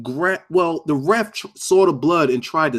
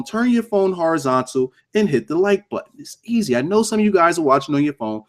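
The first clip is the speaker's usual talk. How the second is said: usual speaking voice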